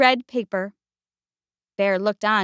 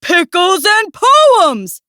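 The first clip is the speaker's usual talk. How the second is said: loud, slow, higher bellow; 7 semitones higher, 5 dB louder, slower than usual. A narrator's reading. Bear insists on his Christmas plans, slow bellow. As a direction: bellow it out grandly. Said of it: "bellowed Bear".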